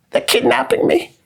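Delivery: high-pitched mock cry for help; Greta Thunberg. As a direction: falsetto impression of a kidnapped activist. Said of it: "Now she's about to get kidnapped".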